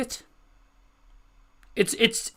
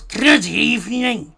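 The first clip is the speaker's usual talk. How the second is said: slow, drawn-out Scouse good evening; Scouse accent; 6 semitones higher, 6 dB louder, slower than usual. Retold or imitated. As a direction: stretch good evening in a thick Liverpool voice. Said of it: "When I do the, uh, Scouse".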